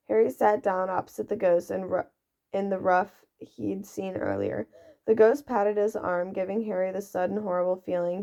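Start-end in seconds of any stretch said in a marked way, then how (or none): none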